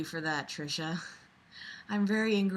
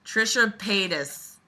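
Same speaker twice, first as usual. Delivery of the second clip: normal talking voice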